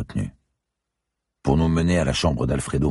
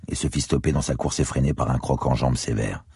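ordinary delivery, nothing marked

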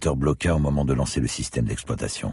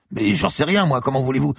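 much higher, indignant student; exasperated protest; 13 semitones higher, 4 dB louder than usual. Acted. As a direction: throw up your hands, flustered. (étudiant outré, voix très aiguë)

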